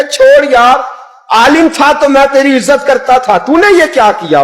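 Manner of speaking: high-pitched, reproachful; mock quarrel. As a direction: scold an old friend. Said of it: "झगड़ने लगे". (ऊँची आवाज़ में झगड़ता बुज़ुर्ग)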